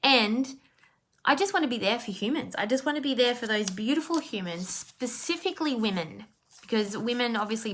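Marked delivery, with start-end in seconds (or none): none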